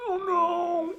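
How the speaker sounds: high-pitched little "oh no"; mock alarm. Friends caught between bear mum and cub, mock alarm. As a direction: retell it as a high, comic uh-oh. Group's reaction to seeing cub.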